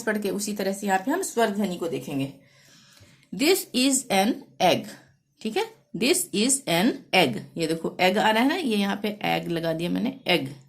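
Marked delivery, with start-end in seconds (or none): 3.3-4.9 very slow, each word spaced out; slow dictation. Shown as a demonstration. Teacher reading an example sentence to pupils, slow and clear. 6.0-7.4 slow, even repeat of the sentence; slow dictation. Shown as a demonstration. Teacher repeating the sentence for pupils, slow and clear.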